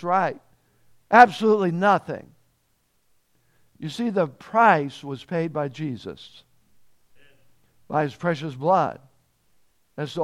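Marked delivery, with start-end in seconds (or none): none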